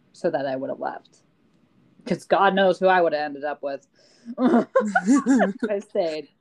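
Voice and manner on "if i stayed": slow, laughing through words. Real, not acted. Laughs at her past.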